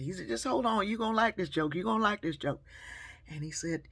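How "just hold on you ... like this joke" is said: louder, higher coaxing; insistent stranger. Retold or imitated. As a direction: hold her there, insisting. Stranger insisting she stay.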